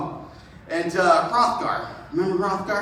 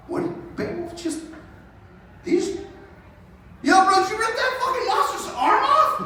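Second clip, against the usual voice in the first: much higher, awed disbelief; stunned; 9 semitones higher than usual. Acted. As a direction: gasp at the impossible feat. Stunned warriors gawk at Beowulf.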